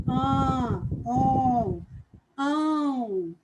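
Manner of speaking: strongly nasal An, on, ão, each held out through the nose; nasal vowel demo. Shown as a demonstration. Teacher showing pupils the nasal sound, nasalized and drawn out. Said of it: "é como se o som tivesse saindo pelo nariz".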